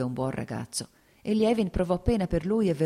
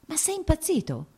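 much higher, shocked cry of alarm; horrified cry; 14 semitones higher than usual. Acted. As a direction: cry out in horror at his madness. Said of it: "gridò Dolly con orrore".

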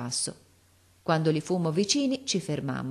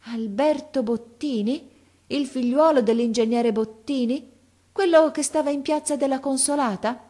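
put-on higher, slowly dawning old man's recognition; slow, wondering; 8 semitones higher, 6 dB louder than usual. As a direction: draw the name out as memory returns.

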